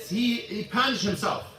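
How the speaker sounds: normal talking voice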